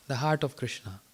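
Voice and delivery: quiet, reflective repeat of "The Heart of Krishna"; soft echo. Shown as a demonstration. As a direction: echo the title quietly to let it sink in. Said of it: "He gave the title The Heart of Krishna".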